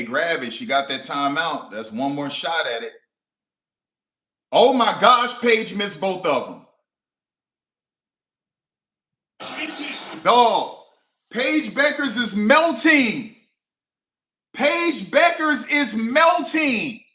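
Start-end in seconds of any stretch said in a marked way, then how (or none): 4.5-10.8 loud, high cry of disbelief; shocked outburst. Real, not acted. Fan yelling at the missed free throws in shock. 11.3-13.3 loud, high and slow, stretched out; excited yell. Real, not acted. Fan shouting about the player melting, loud and stretched. Repeating the meltdown line. 14.5-17.0 even higher, slower, drawn-out yell; louder disbelief. Real, not acted. Fan shouting it again, higher and slower in disbelief.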